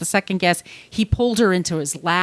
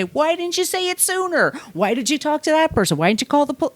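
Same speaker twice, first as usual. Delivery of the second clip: higher, fast accusatory questions; mock accusing; 8 semitones higher than usual. Imitating people who blame a whistleblower, mock accusing. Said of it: "would you go up to that person and say".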